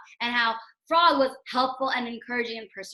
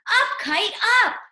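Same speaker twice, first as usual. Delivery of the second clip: higher, louder cheer to the kite; shout; 8 semitones higher, 6 dB louder than usual. Acted. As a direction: call the kite up, raising the voice. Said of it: "and shout".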